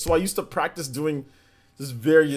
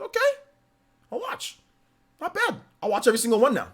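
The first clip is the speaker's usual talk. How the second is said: excited; 12 semitones higher than usual. Retold or imitated. Acting own excited reaction.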